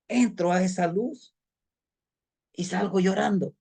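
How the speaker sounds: a hint of sorrow in a retold trance journey; storyteller reliving a journey. Retold journey in first person.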